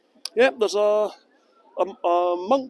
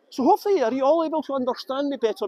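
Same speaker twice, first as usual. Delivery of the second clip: posh voice; 5 semitones higher than usual. Real, not acted. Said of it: "I am using my posh voice".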